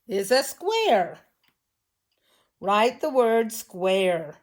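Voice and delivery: slow, clear dictation of the word. Shown as a demonstration. Teacher dictating a spelling word, slow. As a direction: say it slowly so children can write. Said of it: "This shape".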